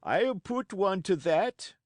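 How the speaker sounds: put-on ship's old seaman agreeing hotly; old seaman crying out. A narrator's reading. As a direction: call it out like a grizzled old tar. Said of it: "cried the old mahogany-faced seaman".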